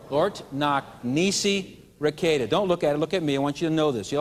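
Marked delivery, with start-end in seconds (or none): 0.1-2.5 slow, even "Lort knock nisi requeta"; slow repeat. Shown as a demonstration. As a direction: lay it out for them to echo. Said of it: "Say it again".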